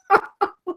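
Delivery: quick high laugh; high giggle. Real, not acted. Vlogger amazed at all the orders, high giggle. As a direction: burst out giggling.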